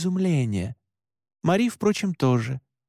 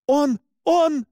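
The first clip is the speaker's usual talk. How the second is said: very high, louder panicked stammer; panicked yelling; 13 semitones higher, 5 dB louder than usual. A narrator's reading. Narrator trying to name what Alexander is, panicked yell. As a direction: yell in panic, unable to finish. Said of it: "заорал я".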